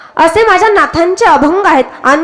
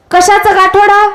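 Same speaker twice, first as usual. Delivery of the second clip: slow, raised-pitch quiz on गाठोडं; emphatic repeated question; 4 semitones higher, 3 dB louder than usual. Real, not acted. Preacher re-asks listeners who have not answered, emphatic question. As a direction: draw out गाठोडं, higher, prompting the crowd. Repeats after asking if audible.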